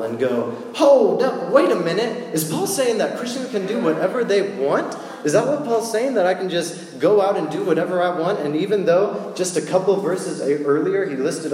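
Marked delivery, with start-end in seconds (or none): 0.7-6.5 high, loud startled protest; a hammed-up double take; shocked objection. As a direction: jump in like you can't believe it. Imagined shocked listener reacting.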